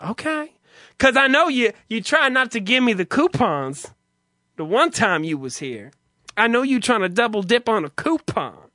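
high, sassy clerk impression; feminine male clerk. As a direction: accuse the customer in a mock effeminate voice. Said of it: "He's very feminine sounding".

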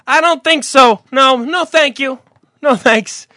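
high, loud curt refusal; prissy old folks turning him down; old person impression. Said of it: "doing an impersonation of them".

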